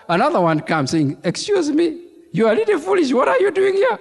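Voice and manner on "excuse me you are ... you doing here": 1 mocking officer. Mocking officer voiced in story.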